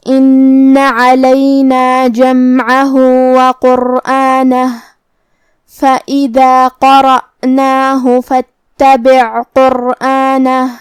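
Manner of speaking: slow, measured tajweed chant; Quran recitation. Shown as a demonstration. Teacher recites the verses slowly for students. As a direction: recite slowly, each letter clear. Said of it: "recites verses in tajweed".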